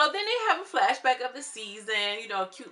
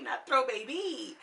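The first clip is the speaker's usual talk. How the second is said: ordinary talk, nothing marked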